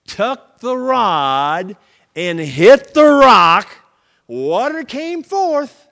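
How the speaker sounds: loud, very slow emphasis; dramatic slow. A narrator's reading. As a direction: hammer each word like the blow. Moses strikes rock.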